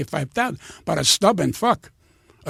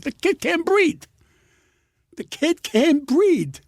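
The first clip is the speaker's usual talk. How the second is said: high, stretched-out alarmed cry; hammed-up frantic parent; mock panic; 8 semitones higher, slower than usual. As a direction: wail it high, slower the second time. Parent panics over screaming baby.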